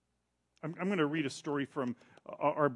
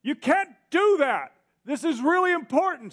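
pleading shout; 12 semitones higher, 10 dB louder than usual. Retold or imitated. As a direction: beg the screen to stop. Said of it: "I just went".

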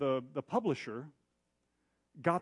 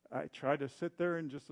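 ordinary talk, nothing marked

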